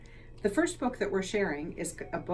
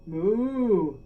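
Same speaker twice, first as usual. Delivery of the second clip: loud, long lowing "Moo, moo"; cow moo; 5 dB louder than usual. Acted. Cow asking the librarian for something to do, mooing. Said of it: "All she heard was".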